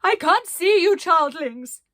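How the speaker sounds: high, loud lost call; shouting. Acted. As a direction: shout for the missing group. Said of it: "she shouted".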